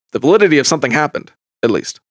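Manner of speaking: ordinary delivery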